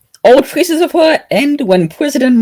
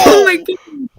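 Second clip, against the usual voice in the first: appalled wailing; 10 semitones higher than usual. Real, not acted. Reacting to a cringeworthy line, appalled wailing. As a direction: wail in comic horror at what was read.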